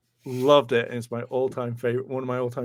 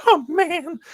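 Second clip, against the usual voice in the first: high, loud wail of letdown; disappointed fan impression; 6 dB louder than usual. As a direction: whine it way up high, crushed. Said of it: "he was like".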